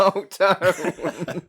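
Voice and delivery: high, laughing protest; can't stop giggling. Real, not acted. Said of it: "I start giggling".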